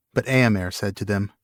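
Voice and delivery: ordinary delivery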